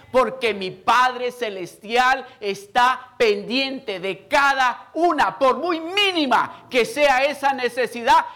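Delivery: loud, high, slow sermon; full-force preaching. Acted. Preacher urging listeners to defy the enemy, full-force preaching. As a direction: proclaim each phrase slowly at the top of the voice.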